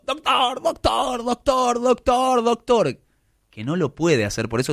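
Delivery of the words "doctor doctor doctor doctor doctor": high mock cries of doctor, over and over; father yelling for the doctor. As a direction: call the doctor like a frantic dad, pitched up. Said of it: "al papá del boxeador que está gritando".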